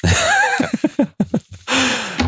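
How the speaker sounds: a short, high-pitched laugh. Real, not acted. Laughs after party promotion.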